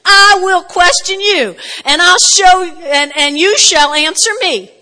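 God reading the riot act, slow and loud; booming God voice. Retold or imitated. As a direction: boom it out as God challenging Job. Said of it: "So he says".

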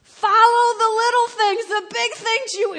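loud, high exhortation; full-force preaching. Real, not acted. Preacher urging obedience in little things, full-force preaching. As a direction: hammer the instruction home loudly.

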